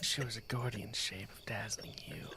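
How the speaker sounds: whispered mock-dreamy reading. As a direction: tease the poem in a hushed whisper. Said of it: "that's your dreamy voice".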